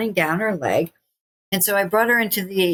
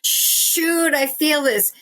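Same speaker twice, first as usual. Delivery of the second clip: high, strained mock complaint; acted pain; 8 semitones higher, much slower than usual. Shown as a demonstration. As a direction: hiss into it, pitched high through the pain. Models breathing through painful stretch.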